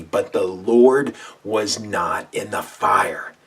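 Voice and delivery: slow, loud, every word weighted; slow call-and-response. Shown as a demonstration. Preacher leading listeners to repeat, slow and loud. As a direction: lead the congregation word by word. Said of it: "Say it out loud with me".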